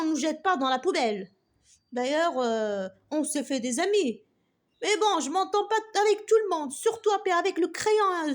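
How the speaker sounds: put-on loud, grumbling old eraser voice; grumpy. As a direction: grouse loudly like a grouch. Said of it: "d'un ton grincheux". (vieille gomme grincheuse qui ronchonne fort)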